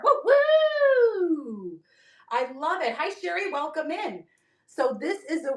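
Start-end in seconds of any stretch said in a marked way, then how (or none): 0.0-1.8 high "woo, woo", last one long and sliding down; celebratory whoop. Real, not acted. Seller cheering a silver viewer, celebratory whoop.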